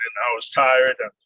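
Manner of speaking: ordinary delivery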